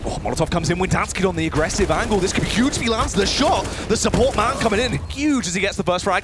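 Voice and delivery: excited high play-by-play; esports caster. Real, not acted. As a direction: build the hype with every kill. Caster calls clutch round.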